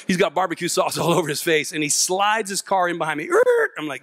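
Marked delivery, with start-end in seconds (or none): 3.3-3.7 loud, very high mouth-made skid; imitated screeching brakes; tyre screech. Police car sliding in behind him, high loud mock screech.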